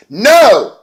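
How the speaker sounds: high, loud, drawn-out refusal; hammed-up cry of protest; mock outraged shout. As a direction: yell it like a thwarted diva. Imitates Maria refusing the match.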